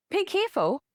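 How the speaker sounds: high, falling caution; acted parent's high-pitched heads-up; parent cautioning child.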